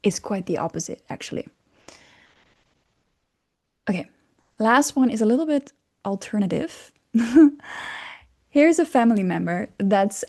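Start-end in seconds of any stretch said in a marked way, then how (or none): none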